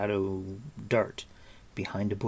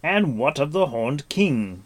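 higher, louder urgent question; quick question; 5 semitones higher, 6 dB louder than usual. A narrator's reading. Gwydion questioning Gurgi about the enemy, quick and urgent. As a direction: ask it with urgency. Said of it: "Gwydion asked quickly".

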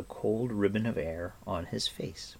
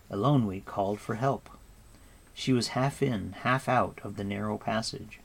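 ordinary delivery, nothing marked

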